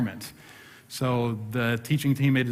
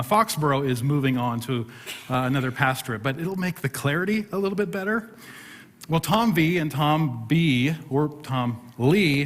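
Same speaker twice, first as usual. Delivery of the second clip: ordinary talk, nothing marked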